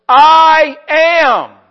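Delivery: a sudden loud, high cry of I am; ringing proclamation. Acted. Jesus answering the Pharisees, loud ringing declaration. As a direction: throw I am out to the whole room.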